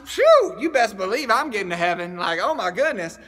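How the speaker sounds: ordinary talk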